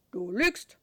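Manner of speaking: loud gruff terrier; barking dog voice. A narrator's reading. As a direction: bark the words like a dog. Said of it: "blafft Charlie".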